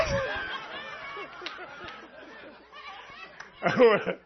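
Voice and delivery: long high laugh; extended, high-pitched laughter. Real, not acted. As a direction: crack up at your own joke. Laughs at his own joke.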